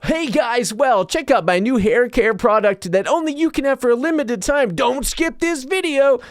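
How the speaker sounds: high, loud, perky influencer pitch. Retold or imitated. Mocking a TikTok hair care ad, perky influencer voice. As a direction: sell it like a bubbly TikTok ad. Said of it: "the video is just like".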